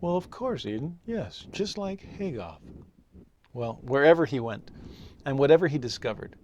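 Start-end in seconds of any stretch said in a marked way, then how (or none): 0.0-2.6 higher, softer, playing along with a child; straight-faced agreement. Astonished dad answering his five-year-old, trying to keep a straight face. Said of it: "tried to keep a straight face and go".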